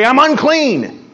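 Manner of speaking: hammed-up prudish recoil; mock scolding. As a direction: recoil with a hint of scolding disgust. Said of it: "He doesn't scold her and say".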